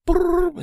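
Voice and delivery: high, loud trilled phone ring. Retold or imitated. Wife calling back on the phone, loud trilled ring. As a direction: imitate a ringing phone with a trill.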